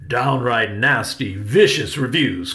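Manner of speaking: loud, theatrical outrage; projected, emphatic indignation; indignant cry. A narrator's reading. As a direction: cry out each insult with force. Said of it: "cried Uncle Riley".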